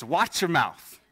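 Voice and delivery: lightly voiced mother's warning; mama's voice. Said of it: "heard it in their mama's voice".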